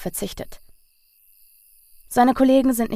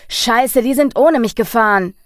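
angry curse; 3 semitones higher, 7 dB louder than usual. A narrator's reading. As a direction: swear loud in shock. Realises he's left behind.

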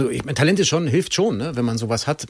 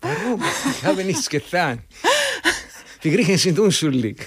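playful Greek Dimi protesting innocence; a hint of a mock-innocent Greek man; Dimitri voice; 6 semitones higher than usual. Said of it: "der Dimi ist am Telefon".

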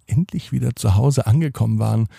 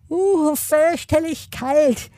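very high, slow penguin shiver; drawn-out squeaky penguin complaint; squawky bouncy penguin; slower than usual. Acted. Penguin tastes the ice cream.

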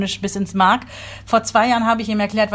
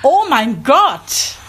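drawn-out, louder exasperated groan of a word; exasperated outburst; 4 semitones higher, 5 dB louder, much slower than usual. Real, not acted. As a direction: let it burst out slowly and loud. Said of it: "Dann geht aber hier sofort so der Dampfdruck". (langgezogen, genervt und laut)

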